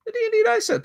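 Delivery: very high squeal; squeaky high voice. Pretend. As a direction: squeal it in a tiny voice. Repeats the reveal.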